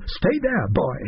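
high-pitched urgent order; bellowed. A narrator's reading. Narrator on the floor to Billy at the door, contained bellow. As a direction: bellow a warning without much added volume. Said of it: "threw myself to the floor and bellowed".

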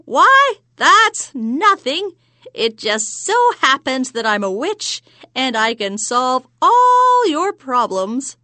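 high, loud, slow cackling hag; witch voice. Acted. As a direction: crow it out like a witch. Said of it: "Chortled the hag".

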